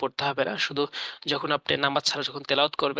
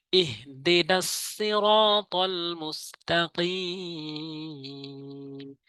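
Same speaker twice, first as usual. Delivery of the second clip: slow, drawn-out chanted recitation; Quran recitation; 3 semitones higher, much slower than usual. Shown as a demonstration. Teacher recites the verse for viewers, slow and chanted. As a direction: stretch each long vowel like tajweed. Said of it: "এখানে ছোট হা উচ্চারণ করতে হবে".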